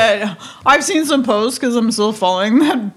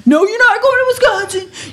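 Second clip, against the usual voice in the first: mock officer; 12 semitones higher than usual. Officer scolding traveller about Wisconsin, hammed-up high mock voice. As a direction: lecture like a pompous official. Imitating stern customs officer.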